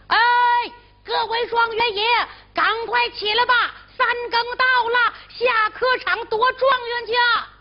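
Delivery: shouting up the sleeping guests; calling out. Acted. Innkeeper calling guests awake.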